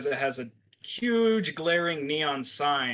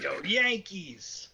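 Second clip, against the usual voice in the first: slow New York drawl; a touch of New York cabbie; New York accent; 7 semitones higher, much slower than usual. Acted.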